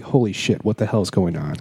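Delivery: ordinary talk, nothing marked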